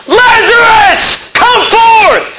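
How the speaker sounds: full-throated, high, drawn-out yell; huge high shout, stretched slowly; loud cry. Acted. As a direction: call the dead out at the top of your lungs. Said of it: "He cried with a loud voice".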